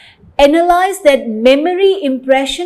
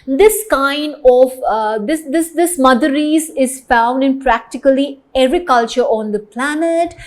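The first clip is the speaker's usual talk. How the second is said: ordinary delivery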